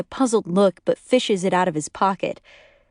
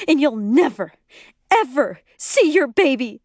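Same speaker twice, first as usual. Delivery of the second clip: vengeful threat; 8 semitones higher than usual. A narrator's reading. Glaring wife threatens husband before leaving.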